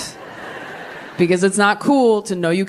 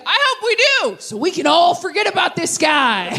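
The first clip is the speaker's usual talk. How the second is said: much higher, shouted jealous outburst; mock jealous shout; 10 semitones higher than usual. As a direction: blurt it out like a fed-up husband. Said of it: "He's like".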